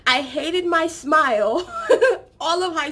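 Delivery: laughing through the words. Real, not acted. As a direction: let a laugh break through. Laughing at own remark.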